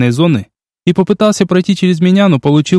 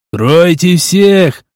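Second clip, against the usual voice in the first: yelling; 4 semitones higher, much slower than usual. A narrator's reading. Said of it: "орал Макс".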